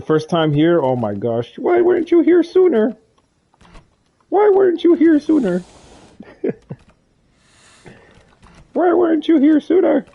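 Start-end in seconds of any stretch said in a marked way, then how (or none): 1.6-10.0 much higher, louder squeaky voice; playful high-pitched welcome; squeaky mock voice. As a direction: squeal it like a cartoon character. Mock greeting a new player.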